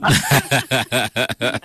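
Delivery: a real bright burst of laughter; laughing. Real, not acted. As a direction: laugh it off warmly. Guest laughs at her own state.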